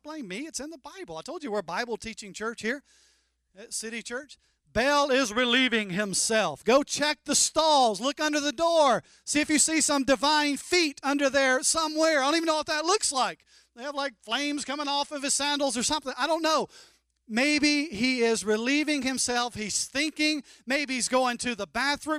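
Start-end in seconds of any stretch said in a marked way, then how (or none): 4.7-16.7 loud, much higher mocking rant; trash talk. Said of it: "a little bit of trash talk". 17.3-22.2 loud, much higher mocking rant; trash talk. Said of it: "trash talk".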